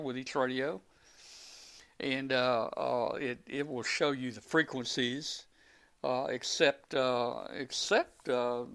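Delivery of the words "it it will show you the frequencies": normal talking voice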